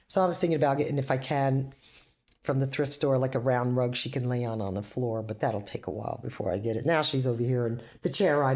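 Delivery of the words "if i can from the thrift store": normal talking voice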